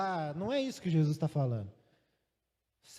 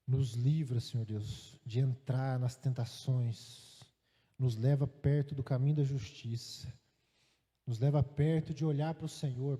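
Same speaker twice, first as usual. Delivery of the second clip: prayer; 6 semitones lower than usual. Acted. Preacher praying aloud to God in the service, low and humble.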